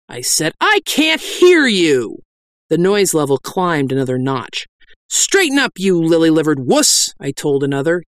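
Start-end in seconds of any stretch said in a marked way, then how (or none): 0.6-2.2 slow, high, drawn-out shout; demanding shout. Acted. Preacher demands louder confession. 5.1-7.1 high, sharp opening order; berating. Acted. Berating a penitent.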